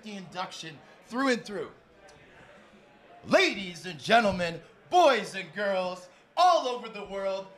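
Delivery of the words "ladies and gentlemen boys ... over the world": slow, louder showman's call to the crowd; big booming host welcome; announcer hype. Acted. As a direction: drawl it out like a ring announcer. Host hypes the audience.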